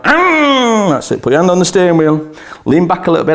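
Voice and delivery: ordinary talk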